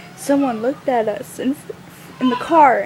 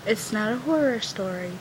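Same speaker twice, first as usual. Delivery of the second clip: ordinary delivery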